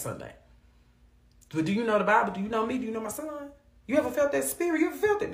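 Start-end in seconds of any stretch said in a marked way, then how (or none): 1.5-5.3 high, rising questions piled up; climbing, urgent imagined interrogation; insistent questioning. Acted. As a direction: fire off mock-heavenly questions, pitched high. Acts out God's questioning on judgment day.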